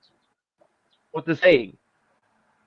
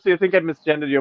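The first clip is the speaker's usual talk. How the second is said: ordinary talk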